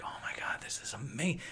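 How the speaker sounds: whispered amazement; hushed awe. Retold or imitated. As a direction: whisper it, awestruck by the view. Retelling first awed view of mountains.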